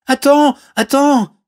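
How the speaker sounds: high, loud princess's cry. Acted. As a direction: urgently call someone back. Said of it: "cria la princesse". (cri aigu de princesse)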